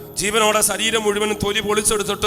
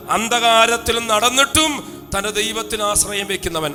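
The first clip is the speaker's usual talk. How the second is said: normal talking voice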